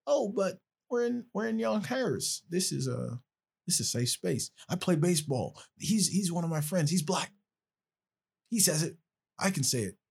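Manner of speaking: sneering high-pitched imitation of a student justifying himself. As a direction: parody an entitled classmate making excuses.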